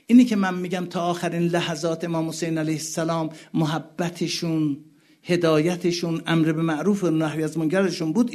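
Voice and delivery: loud, high pleading sermon voice; impassioned preaching. Acted. Preacher voicing Husayn pleading with the army, loud. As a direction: plead with the crowd.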